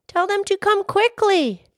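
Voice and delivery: high, loud plea; urgent yell. Acted. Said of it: "Peter yelled".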